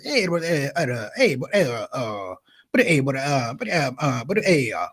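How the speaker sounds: stammering dad like an engine failing to start; low, halting imitation of a mumbling father; sputtering father. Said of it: "sounds like a car trying to start in the cold".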